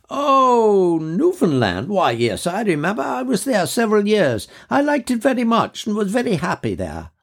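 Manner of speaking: a lady of mature years, fondly remembering; high, fluting voice of an officer's widow; elderly widow. Acted. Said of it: "an officer's widow, a lady of mature years".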